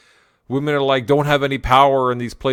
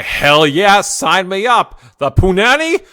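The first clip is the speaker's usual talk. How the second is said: mock eager yell; 8 semitones higher, 8 dB louder than usual. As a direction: shoot up much higher and louder, hammed-up eager cheering. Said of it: "are all like".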